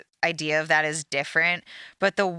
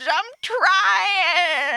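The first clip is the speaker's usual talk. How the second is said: higher, louder mock sob; crying whine; 12 semitones higher, 5 dB louder than usual.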